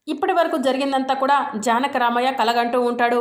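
usual speaking voice

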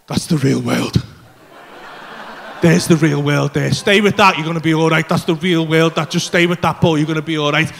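low, loud, insistent voice; cling to it and talk yourself down; intense self-reassurance. Retold or imitated. Talking himself down, fixed on painting.

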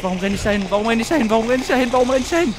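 frantic looping question; building panic. Real, not acted. Panic while running toward danger.

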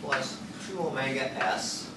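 usual speaking voice

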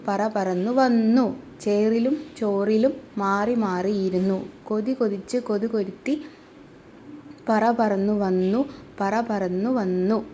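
put-on slow, lilting nursery rhyme for little kids; sing-song rhyme recitation. Teacher reciting the rhyme to class two, slow and sing-song. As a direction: chant the verse slowly, word by word.

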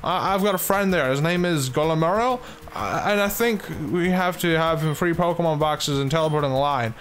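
high-pitched, over-eager caller voice; Barrow. Acted. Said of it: "You've got a call from Barrow".

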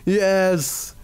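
high, gleeful yes; gleeful shouting. Real, not acted. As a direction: crow with glee. Trap springs on victim.

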